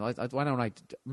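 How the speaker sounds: stumbling stutter. Real, not acted. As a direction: trip over the words. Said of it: "I'm stuttering here".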